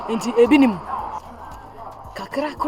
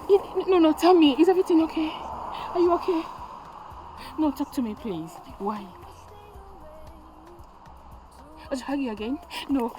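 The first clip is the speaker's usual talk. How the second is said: soft, high desperate pleas; desperate pleading; 3 semitones higher, 7 dB quieter than usual. Acted. Mother softly begging her daughter to talk. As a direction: beg quietly, near tears.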